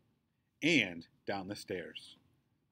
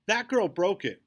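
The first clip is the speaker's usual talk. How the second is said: high, loud accusation; roaring; 9 semitones higher, 9 dB louder than usual. A narrator's reading. Second bully blaming the girl, roaring. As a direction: roar the blame at her. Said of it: "roars bully head number two".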